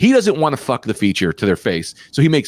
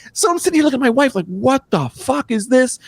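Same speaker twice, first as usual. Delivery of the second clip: high stunned exasperation; outraged disbelief; 11 semitones higher than usual. Real, not acted. Outraged retelling of racist gift.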